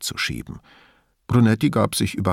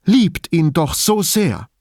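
high, slow, imploring declaration; theatrical; 7 semitones higher, 4 dB louder than usual. A narrator's reading. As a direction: declaim it like a melodrama heroine. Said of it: "erklärte theatralisch".

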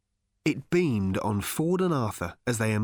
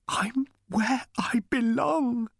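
slow, higher whisper, moved; emotional whisper; 13 semitones higher, slower than usual. A narrator's reading. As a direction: breathe it out, overcome with feeling. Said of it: "he said in an emotional whisper".

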